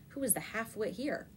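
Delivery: ordinary delivery, nothing marked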